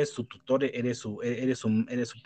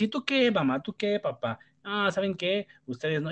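higher, bratty mock child talking back; defiant kid; 7 semitones higher than usual. A spoiled child talking back to mom and dad, bratty and high. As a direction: sass the parents like a spoiled kid.

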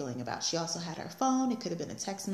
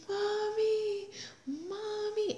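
put-on high, wailing little-girl Mommy; crying toddler; much slower than usual. Mother imitating daughter at drop-off, high wailing child voice. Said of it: "like somebody killed her puppy cry".

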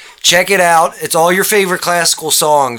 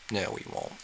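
quiet; 8 semitones lower, 21 dB quieter, faster than usual. Pretend. Joking threat then quiet retraction.

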